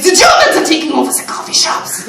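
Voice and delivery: thick mock German accent to a customer; over-the-top fake German accent, laughing. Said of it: "slip in and out of the accent".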